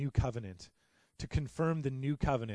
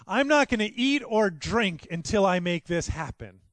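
loud, higher declaration of a vow; vowing oath; 7 semitones higher, 9 dB louder than usual. Retold or imitated. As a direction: swear it like an oath.

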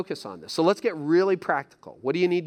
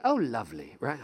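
lightly put-on British voice; British accent; 4 semitones lower, 4 dB quieter than usual. Preacher joking about a British-sounding word, light British accent. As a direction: say it with a touch of British. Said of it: "Sounds British, right?".

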